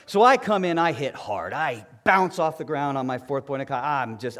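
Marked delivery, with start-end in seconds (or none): none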